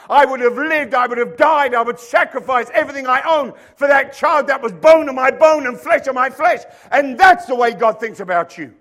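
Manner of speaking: loud, high-pitched preaching; fiery preaching. Acted. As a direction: preach it with full force. Preaching at full force.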